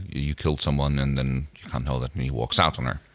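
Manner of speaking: ordinary talk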